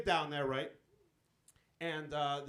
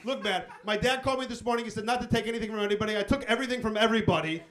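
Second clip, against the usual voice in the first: higher, louder, rushed explanation; panicked pleading; 4 semitones higher, 8 dB louder than usual. Retold or imitated. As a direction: blurt it out to get off the hook. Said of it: "And I was like".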